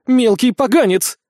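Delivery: angry hiss. A narrator's reading. Witch insulting the boy who embarrassed her, angry hiss. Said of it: "прошипела ведьма".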